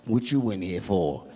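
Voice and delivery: lightly voiced bum, pitched low; low, subdued bum voice. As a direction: toss it out low like a jailhouse bum. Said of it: "This bum is like".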